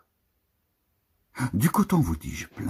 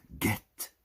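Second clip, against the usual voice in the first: a sharp whisper; whispered urging; 6 dB quieter than usual. Acted. Reader urging the listener to watch, whispered. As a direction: breathe it as a secret warning.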